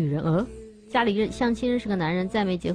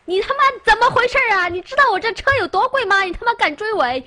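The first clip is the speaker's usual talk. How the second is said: much higher, louder and fast, swearing at the driver; furious cursing shout; 13 semitones higher, 6 dB louder than usual. Pretend. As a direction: yell like a rich man whose supercar got rear-ended. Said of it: "破口大骂说". (下车冲着追尾的人破口大骂)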